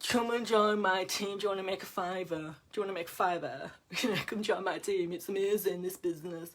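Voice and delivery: a lightly voiced, pushy recruiting pitch; mock desperate recruiter. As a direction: sell the team half-heartedly, slightly cheesy. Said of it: "I would be like".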